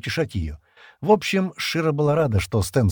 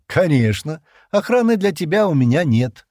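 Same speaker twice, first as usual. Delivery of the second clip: loud, high-pitched taunt; mocking; 8 semitones higher, 6 dB louder than usual. A narrator's reading. Стэн refusing Джемма an escort, mockingly. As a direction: jeer it, voice raised. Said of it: "насмешливо ответил Стэн".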